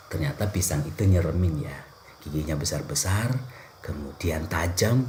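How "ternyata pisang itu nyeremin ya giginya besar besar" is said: ordinary talk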